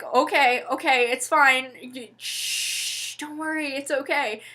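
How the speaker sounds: put-on calm a crying child gently; soothing. Stepmom calming crying girl.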